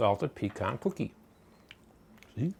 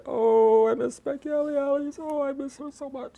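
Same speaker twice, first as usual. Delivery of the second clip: missing the dog. Real, not acted. Missing her late dog.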